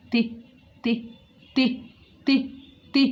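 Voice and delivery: "ت" as a very short light te, with pauses between; isolated repeated sound. Shown as a demonstration. Teacher repeats the doubtful letter to herself, short isolated "ت". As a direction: repeat a bare "ت", one short burst at a time. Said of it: "وأكرره بيني وبين نفسي تلات أربع مرات".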